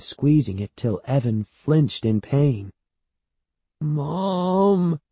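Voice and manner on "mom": put-on high, stretched-out child's whine; pleading. A narrator's reading. Boy hurt by his aunt's squeeze, pleading. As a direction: draw the word out in appeal. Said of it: "he pleaded".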